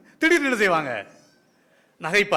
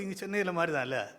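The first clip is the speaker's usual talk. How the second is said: ordinary talk, nothing marked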